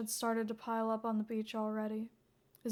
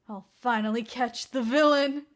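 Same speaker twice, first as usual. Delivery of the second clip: manic laugh; 2 semitones higher, 10 dB louder than usual. Acted. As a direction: laugh like he's had far too much coffee. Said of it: "He laughed like someone who'd chugged too much caffeine".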